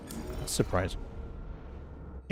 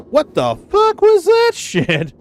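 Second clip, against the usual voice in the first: shocked; 5 dB louder, slower than usual. Real, not acted. Shocked by hard dip.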